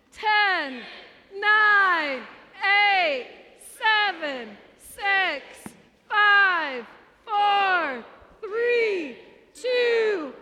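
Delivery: loud, rising countdown shout; new year countdown crowd. Real, not acted. Pastor leads New Year countdown.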